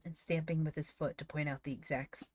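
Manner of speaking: ordinary delivery, nothing marked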